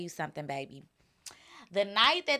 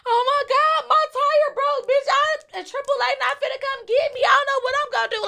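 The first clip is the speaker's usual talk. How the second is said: much higher fake panicked girlfriend voice; panicked friend voice; 14 semitones higher, 3 dB louder than usual. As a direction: squeal it like a friend stuck with a flat tire. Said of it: "it's gonna be like".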